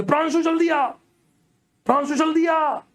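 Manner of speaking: पुकारते हुए ऊँची, ज़ोरदार आवाज़ में दोस्त को बुलाना; घबराकर दोस्त का नाम ऊँचे सुर में पुकारो; calling out. Retold or imitated. Calling friend in panic, snake. (कमरे के पार दोस्त को ज़ोर से आवाज़ देना)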